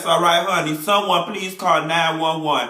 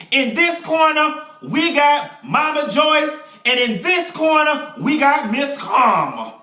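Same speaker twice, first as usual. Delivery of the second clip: slow, higher hammed-up fight announcer; ring announcer; 7 semitones higher, slower than usual. Introducing Mama Joyce and Karma like a ring announcer. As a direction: call out the fighters like a boxing match.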